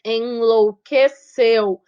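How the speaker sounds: "enlouqueceu" very slowly, syllable by syllable, weight on "ceu"; stress on final syllable. Shown as a demonstration. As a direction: stretch out "enlouqueceu" and land on the last syllable. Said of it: "É a sílaba forte".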